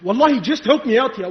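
ordinary talk, nothing marked

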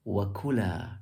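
"Wa kula" with the ku stressed; "Wa kula", pitch peaking on ku, then falling; stress on second syllable. Shown as a demonstration. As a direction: lean on the ku in "Wa kula". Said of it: "to emphasize the kaf".

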